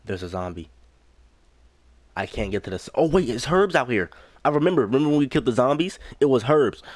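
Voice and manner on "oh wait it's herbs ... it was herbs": higher, louder burst of remembering; light up with sudden recall; excited realisation. Real, not acted. Player suddenly remembering herbs, excited realisation.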